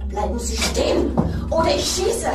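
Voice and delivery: loud, rising to a high panicked yell; terrified woman holding a gun on the killer; desperate shout. Acted. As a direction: scream the threat to stop him.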